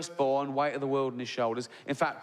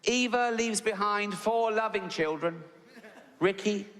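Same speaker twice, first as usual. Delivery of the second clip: slow, high, solemn vicar reading the eulogy; pompous vicar; 4 semitones higher than usual. Retold or imitated. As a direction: lay it on like a self-important vicar at the pulpit. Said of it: "And he goes".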